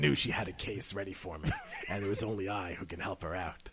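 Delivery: low, soft hard-boiled narration; noir detective. Acted. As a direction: brood like a noir gumshoe. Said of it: "the noir?".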